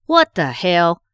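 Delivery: sharp loud shocked outburst; angry outburst. Acted. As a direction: spit it out, furious and stunned. Wife walks in on betrayal.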